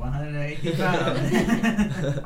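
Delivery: slow, loud boxing-announcer drawl; hammed-up ring announcer with a laugh; mock ring announcer. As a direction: announce him like a prizefighter.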